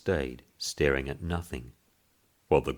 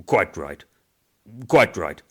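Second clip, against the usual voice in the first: loud, rising quite right; cried out; 3 semitones higher, 8 dB louder than usual. Acted. Boss agreeing with the old man, loud cry. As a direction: cry it out absently. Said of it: "cried the boss".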